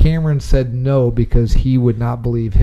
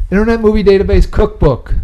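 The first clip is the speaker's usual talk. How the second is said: announcer voice; 7 semitones higher, 4 dB louder than usual. Acted. Announcing the game segment.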